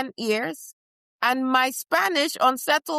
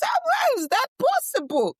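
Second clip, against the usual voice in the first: indignant disbelief. Acted.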